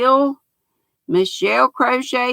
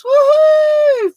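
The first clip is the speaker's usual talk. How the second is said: high, loud celebratory woohoo; excited whoop; 6 dB louder than usual. Real, not acted. Host cheering the viewer count live on stream. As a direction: cheer like you hit a milestone.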